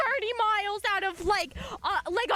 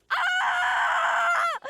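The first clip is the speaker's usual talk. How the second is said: high, loud, held "ah" scream; long high-pitched shriek, retold; acted scream; 12 semitones higher, 5 dB louder than usual. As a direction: scream out in mock panic. Acts out panicked scream in story.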